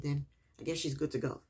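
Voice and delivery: ordinary talk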